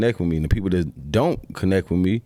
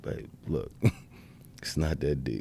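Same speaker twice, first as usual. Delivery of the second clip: calm soothing; 4 semitones lower, 7 dB quieter than usual. Retold or imitated. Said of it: "I might be the guy to be like".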